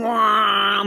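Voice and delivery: high mock whine of a spinning helicopter; helicopter whoosh. Describing the helicopter sliding sideways, high imitated whine. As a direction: make the helicopter's wail, drawn out. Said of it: "Helicopter's just going".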